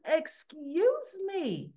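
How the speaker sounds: very slow, drawn-out, pointed excuse me; indignant excuse me. Retold or imitated. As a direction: stretch it out with cold offence. Nurse confronts intruding father.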